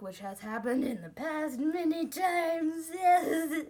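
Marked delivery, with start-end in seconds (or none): 2.9-3.6 loud laughing yes; burst of laughter. Real, not acted. Confessing she cries in public, laughing loudly. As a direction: laugh it out loud, half crying.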